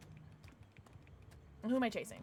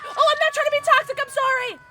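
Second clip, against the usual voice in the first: flustered apology; 13 semitones higher, 9 dB louder, faster than usual. Real, not acted. Apologizing to other player.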